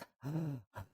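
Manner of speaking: quick gasp to recover breath; gasp for air. Real, not acted. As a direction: catch your breath after pleading. Catching breath after unpunctuated reading.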